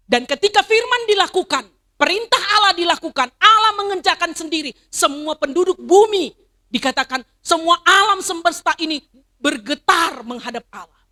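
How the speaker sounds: loud, high proclaiming, each phrase hammered; full-force preaching. Acted. Pastor proclaiming God's power to the congregation, loud and high. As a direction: thunder the promise over the church.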